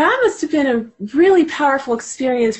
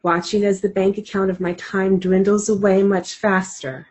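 measured, reflective book reading; reading aloud; 4 semitones lower than usual. Acted. Guest reads a page from the book aloud to listeners, reflective reading.

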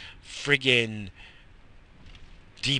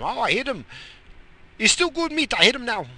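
high put-on eager voice; lightly voiced cook bragging; 11 semitones higher, faster than usual. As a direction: cook proud of his roadkill. Owner voiced admitting roadkill.

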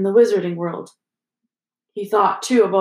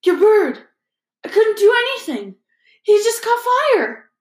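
high, loud, breathless alarm; panicked boy blurting it out; frantic, pitched up and loud; gasping panic; 12 semitones higher, 5 dB louder than usual. Acted. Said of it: "Harry gasped".

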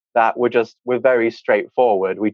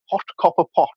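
RP key phrase; 8 semitones higher, faster than usual. Retold or imitated. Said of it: "there were key phrases like".

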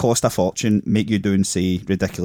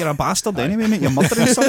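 mock outrage; 10 semitones higher, 4 dB louder, faster than usual.

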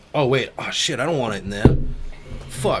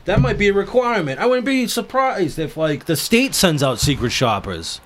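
excited; 6 semitones higher than usual. Real, not acted. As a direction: get more and more fired up. Excited rant rising.

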